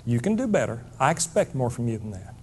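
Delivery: lightly voiced mother, low and quiet; quiet, low motherly reproof; chiding mama. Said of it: "right there saying".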